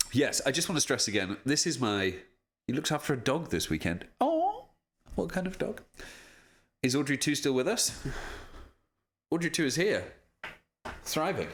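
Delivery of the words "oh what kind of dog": high excited question. Real, not acted. Excited about viewer's dog.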